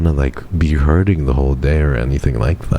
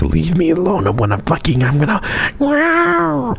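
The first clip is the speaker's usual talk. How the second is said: squeaky, far higher growly wolf whine; little alpha wolf; 14 semitones higher than usual. Shown as a demonstration. Streamer shows chat how she sounds in pain: a tiny alpha wolf. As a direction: play a tiny alpha wolf warning everyone off. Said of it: "alpha wolf sounds".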